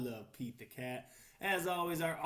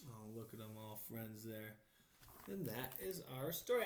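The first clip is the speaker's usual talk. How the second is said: ordinary delivery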